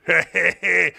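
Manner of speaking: short fake chuckle, amused fan; mock chuckle. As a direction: chuckle along like a fawning viewer. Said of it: "everybody chuckles".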